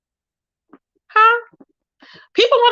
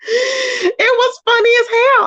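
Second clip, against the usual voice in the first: laughing; 9 semitones higher, slower than usual. Real, not acted. Laughing over the clip.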